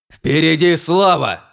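battle cry. Acted. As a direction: roar it to the troops.

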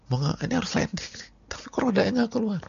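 high, fast, rising panic; panicked passenger. Retold or imitated. Preacher acting a frightened passenger for the congregation, high panicked rush. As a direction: gasp it out as the wheels won't drop. Landing gear fails, panic.

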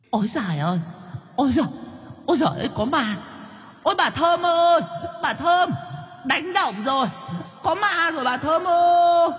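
very high, wailing ghost cry. A narrator's reading. Ghost tumbling from the tree wails for Thơm, very high. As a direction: wail in panic like a frightened ghost. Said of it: "gào lên".

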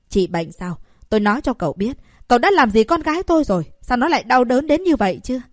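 high, loud furious yelling; angry shout. Acted. Prince rebukes the doctor for hurting his daughter, angry loud shout. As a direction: rage at the doctor over the daughter. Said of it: "nộ quát lên".

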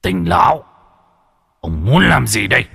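high, loud shouted demand. A narrator's reading. Thiết Quan Âm reads the secret letter and slams the table, loud shout. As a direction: shout it out in outrage. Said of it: "đập tay xuống bàn quát to".

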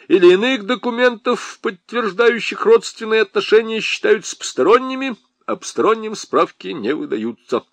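high, loud recited decree; droning official reading. A narrator's reading. Lieutenant recites rules refusing Nyura, officious loud drone. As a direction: drone it out like a bureaucrat reading regulations. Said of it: "словно читал какое-то постановление".

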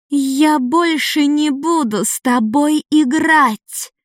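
put-on high, slow furious girl's shriek. A narrator's reading. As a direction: shriek it, done playing. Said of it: "взвизгнула девчонка".

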